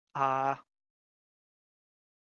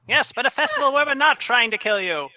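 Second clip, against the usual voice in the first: carnival barker; 6 semitones higher than usual. Acted. Barker answers players.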